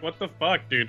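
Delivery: incredulous and giggling; laughing disbelief. Retold or imitated. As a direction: crack up at your own absurdity. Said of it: "and then I laugh because I'm saying something so ridiculous".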